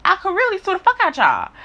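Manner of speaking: real heated indignation; indignant threat. Retold or imitated. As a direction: throw the threat out pitched high. Retelling threat to employer.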